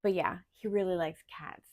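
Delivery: ordinary talk, nothing marked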